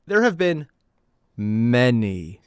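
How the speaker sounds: ordinary talk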